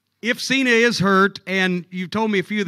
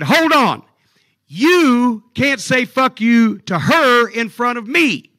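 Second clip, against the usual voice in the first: loud, slow, raised-pitch warning; stern intervention; 4 semitones higher, 7 dB louder, slower than usual. Retold or imitated. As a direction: lay down the law in a retold showdown. Retold intervention in the quarrel.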